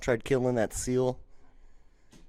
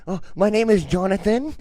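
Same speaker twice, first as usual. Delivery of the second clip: higher pleading prank-call voice; prank caller; 6 semitones higher, 4 dB louder than usual. Retold or imitated. Prank caller tells the front desk a name, mock-desperate. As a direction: play the caller giving a name, mock-helpless. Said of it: "And he's like".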